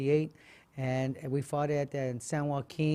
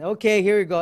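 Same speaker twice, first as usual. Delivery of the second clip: calling out; 5 semitones higher, 10 dB louder than usual. Retold or imitated. Matchmakers calling out fighters.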